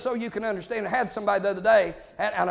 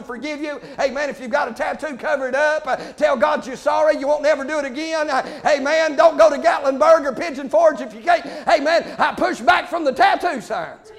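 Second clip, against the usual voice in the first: loud revival-preacher cadence, pushing each line; fiery preaching; 6 semitones higher, 6 dB louder than usual. Acted. Preacher exhorting the church about tattoos, loud fiery preaching. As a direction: hammer it home to the congregation.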